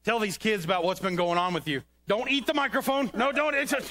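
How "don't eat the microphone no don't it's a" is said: sharp high scold at a puppet; scolding the dog. Acted. Man scolds puppet dog chewing the microphone, sharp higher scold. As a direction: stop him, higher and firmer.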